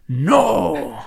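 high, drawn-out "No!"; grito de no. Retold or imitated. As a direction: shout it out dramatically. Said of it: "De hecho, dije así". (grito dramático)

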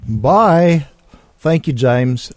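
a hammed-up attempt at a British goodbye; half-hearted mock English accent; bad British accent. Host signing off to James in a bad British accent. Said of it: "there I go again with my bad accent".

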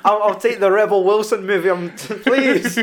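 high, loud plea through laughter; laughing too hard to finish. Real, not acted. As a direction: beg jokingly while cracking up. Joking plea amid shared laughter.

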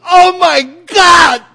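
very high, slow, full-voiced shout; anguished outcry. Congregant suddenly crying out during the sermon, anguished shout. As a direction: wail it out to God in distress. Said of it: "somebody in the congregation suddenly goes".

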